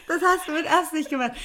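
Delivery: put-on high, laughing kid-like whine; childish repeat. Repeating the accusation to the man, childish with laughter. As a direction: say it again like a sulky child. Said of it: "wie so ein Kind reagiert".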